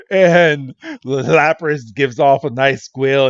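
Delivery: normal talking voice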